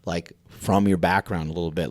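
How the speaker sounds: ordinary talk, nothing marked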